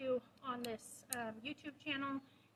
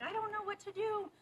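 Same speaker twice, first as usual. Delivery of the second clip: high-pitched, hammed-up cluelessness; helpless uncertainty; 8 semitones higher, 4 dB louder than usual. Trainer voicing two lost companions, helplessly unsure. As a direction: play it lost, pitched well up.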